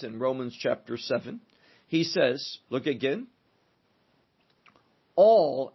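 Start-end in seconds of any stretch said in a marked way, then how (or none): 5.2-5.7 All punched hard and loud; All thrown out alone after a long pause; emphatic all. Shown as a demonstration. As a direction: hit the word All with full weight. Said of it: "I want to emphasize that".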